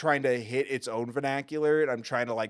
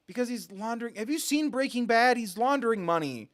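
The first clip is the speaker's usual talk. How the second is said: excited aside; 6 semitones higher, 4 dB louder than usual. Real, not acted. Excited aside about laundering.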